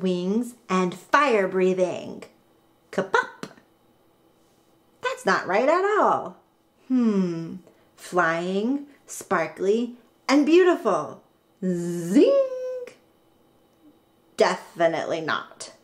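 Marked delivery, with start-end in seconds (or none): 2.9-3.5 high cartoon pop; bright popping noise; popping sound effect. Machine makes a creature. 11.6-12.9 high zinging noise; cartoon zing of magic; sparkly zing; zing sound effect. Machine makes a creature.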